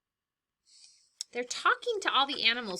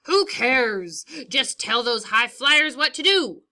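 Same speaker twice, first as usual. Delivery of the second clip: louder, scornful brush-off; dismissive debate jab; 3 semitones higher, 11 dB louder than usual. Acted. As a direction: wave the question away arrogantly. Snake brushes off birds in debate.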